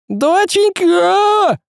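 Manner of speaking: very high, long drawn-out scream; anguished cry. A narrator's reading. Добряк sees his daughter clawed, anguished drawn-out cry. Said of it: "закричал Добряк".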